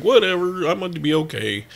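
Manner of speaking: retold carefree brush-off, pitched high then easing down; breezy confidence. Patient recalling walking in for the scan, breezy. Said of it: "I walked in feeling like".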